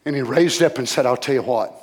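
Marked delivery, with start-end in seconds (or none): 1.1-1.7 low, hushed, measured reply; quoted calm reply. Retold or imitated. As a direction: let it sink down almost to nothing.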